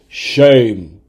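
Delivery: loud, falling mock chant; hammed-up public shaming call; imitated crowd yell. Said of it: "everyone just yells".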